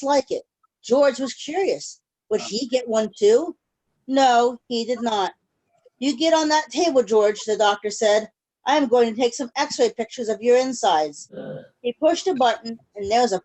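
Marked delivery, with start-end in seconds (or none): none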